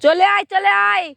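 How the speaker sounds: high, loud call to come over; calling out loudly. Real, not acted. Boy beckons a friend over, calling out loudly. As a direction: holler to someone out of reach. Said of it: "আস্তে কও".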